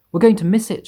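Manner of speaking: high, loud alarmed shout. A narrator's reading. Ladybird shouting in alarm at the rainbow, high and loud. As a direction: yell the warning out. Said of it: "shouted the ladybird".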